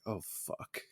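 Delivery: hushed, breathless mock dismay; whispered curse. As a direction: whisper it like you just got caught. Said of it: "He's like".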